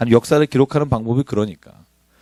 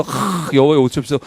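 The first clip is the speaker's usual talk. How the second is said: lightly put-on sighing prayer; mock someone praying at the altar; mock pious sigh. Preacher mocking an offerer at the altar, light sighing plea. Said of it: "제단에 올리면서".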